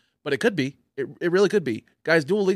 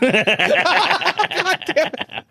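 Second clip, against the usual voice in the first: laughing; 7 dB louder, much slower than usual. Real, not acted. Laughing at the Japan joke.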